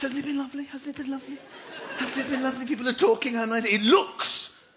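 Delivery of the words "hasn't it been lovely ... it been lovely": softer, higher chatty repetition; mock small talk. Locals chatting about the weather, soft. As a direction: coo it like polite small talk. Ironic repeated chat.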